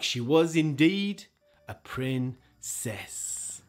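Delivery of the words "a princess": slow soft whisper; storyteller closing the tale, slow whisper. A narrator's reading. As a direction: breathe it like a secret. Storyteller ending softly.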